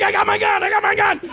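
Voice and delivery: high, loud flustered reply; panicked shout. Retold or imitated. Actor replays shouting back in the audition. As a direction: yell back in mock panic. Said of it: "And I'm like".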